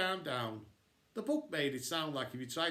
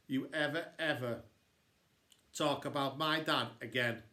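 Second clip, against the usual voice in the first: ordinary delivery, nothing marked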